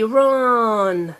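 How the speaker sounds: "run" stretched long; said slowly. Shown as a demonstration. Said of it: "Yeah, slowly".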